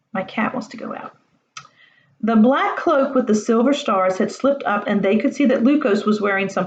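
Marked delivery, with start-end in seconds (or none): 0.1-1.2 soft whispered aside. Real, not acted. Reader telling listeners about her cat, whispered. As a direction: whisper it off to the side.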